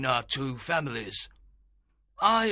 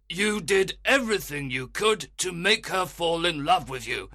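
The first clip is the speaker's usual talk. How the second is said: put-on louder, higher angry old man; 12 semitones higher, 4 dB louder than usual. A narrator's reading. Aegeus rebuking Lysander before the Duke, angry. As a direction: scold the young fellow hotly.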